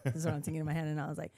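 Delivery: normal talking voice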